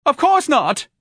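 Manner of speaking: very high, loud retort; furious high-pitched shriek; screaming. A narrator's reading. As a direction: scream it at your husband. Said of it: "Missus Levy screamed at her husband".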